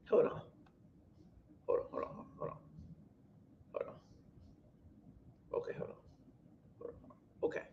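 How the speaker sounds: very soft, barely voiced murmur; real under-the-breath stalling; muttering to self. Real, not acted. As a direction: murmur absently while hunting for something. Stalling while finding the viewers' comments.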